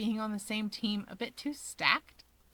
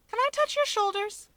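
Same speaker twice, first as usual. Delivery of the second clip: much higher, chirpy and hopeful; eager Natsuo; 12 semitones higher than usual. Acted. Natsuo to Shigaraki, eagerly asking permission. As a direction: ask like an excited puppy.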